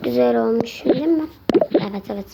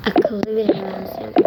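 ordinary talk

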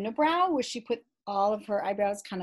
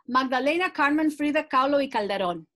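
the name said with a native Spanish accent; pronunciación española; Spanish pronunciation; 4 semitones higher, 6 dB louder than usual. Shown as a demonstration. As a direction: roll it out the way a Spanish speaker would. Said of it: "I said that with the accent that you would say it in Spanish".

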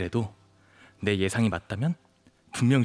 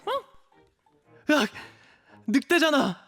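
high-pitched scream of alarm; jumping out of your skin, voice leaping up; startled shriek; 13 semitones higher, much slower than usual. Acted. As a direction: yelp in fright at spotting a wolf. Startled at seeing a wolf.

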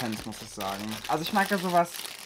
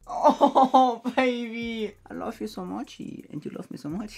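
high cooing voice; 9 semitones higher, 7 dB louder, slower than usual. Real, not acted. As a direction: coo lovingly at the pet. Cooing to the pet too.

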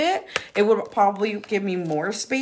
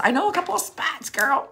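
sassy tease; 6 semitones higher than usual. Shown as a demonstration. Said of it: "I'm like, I know a couple".

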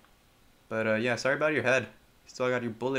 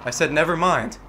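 irritated shout; 2 semitones higher, 10 dB louder than usual. Real, not acted. Repeating angrily.

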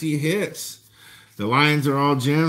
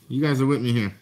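ordinary talk, nothing marked

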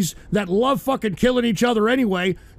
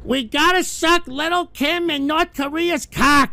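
higher, slow whiny mockery of Trump's diplomacy; mocking Trump; 5 semitones higher, slower than usual. Streamer mocking Trump's diplomacy to chat, in a higher, slow whiny voice. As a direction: drag it out high like a simpering pushover. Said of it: "much like what Trump wants to do".

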